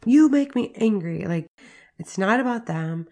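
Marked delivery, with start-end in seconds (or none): 0.0-1.2 accusing parent. Retold or imitated. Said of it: "especially when you're like".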